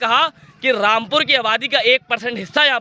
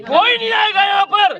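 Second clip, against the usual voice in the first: higher, insistent challenge; raised defiant voice; 6 semitones higher than usual. Real, not acted. Student leader defiantly telling the official that nobody will come. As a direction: push the words up at him in defiance.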